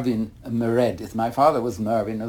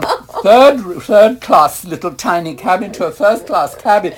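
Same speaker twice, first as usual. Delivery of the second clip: laughing, much higher and louder, slowed; laughing irony; 7 semitones higher, 8 dB louder than usual. Real, not acted. Telling the interviewer about the useless first-class upgrade, laughing. Ironic upgrade after missing boat.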